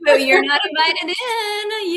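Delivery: high, loud, sing-song brush-off; playful character. Pretend. Guest voicing playful character to her inner doubt. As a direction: cheerfully shut the door on the doubt. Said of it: "go into character and be like".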